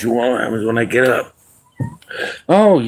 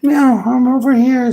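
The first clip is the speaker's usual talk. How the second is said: very high, louder meowing cat voice; a pleading kitten calling for attention, very high; 4 dB louder, slower than usual. Pretend. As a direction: meow up high like a needy kitten.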